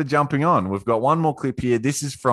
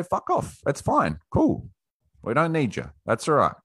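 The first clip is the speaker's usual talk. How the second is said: mock dismissive brush-off; 6 semitones higher than usual. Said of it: "We, we say".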